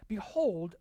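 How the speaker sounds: ordinary talk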